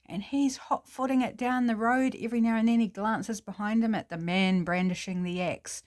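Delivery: usual speaking voice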